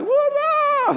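very high whooped greeting. Retold or imitated. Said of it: "Are you always like this?".

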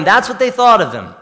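usual speaking voice

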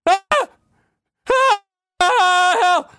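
high, loud wounded wail; hammed-up agonized shrieking; screaming for help. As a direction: scream for rescue like you're badly hurt. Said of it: "he's screaming".

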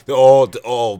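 laughing announcement. Real, not acted.

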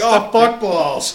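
mock dismayed curse. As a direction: stretch the curse in comic dismay. Acts out someone's exasperated curse.

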